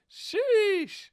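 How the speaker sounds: very high, drawn-out squeal of amazement; preteen squeak. Real, not acted. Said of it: "that sheesh came out a little preteenish".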